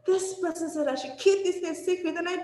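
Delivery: high-pitched appeal to a parent; child telling parent. Acted. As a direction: model a child speaking up, pitched well up. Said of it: "Just say it".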